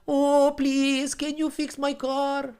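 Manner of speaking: high, begging voice; pleading customer. Retold or imitated. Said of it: "They're like".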